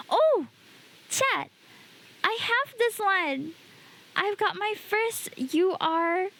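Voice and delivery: thrilled at the pull; excited. Real, not acted. Pulls a good card live.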